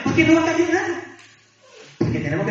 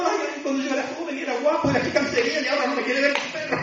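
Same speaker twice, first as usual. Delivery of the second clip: high-pitched comic moan about lost youth; mock weeping lament; 6 semitones higher, 3 dB quieter, faster than usual. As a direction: parody someone crying over the old days. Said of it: "para estar llorando". (lloriqueo fingido, en tono agudo)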